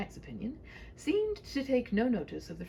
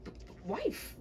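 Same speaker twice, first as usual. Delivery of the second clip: sputtering; 7 semitones higher, slower than usual. Acted. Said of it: "the knight sputtered slightly".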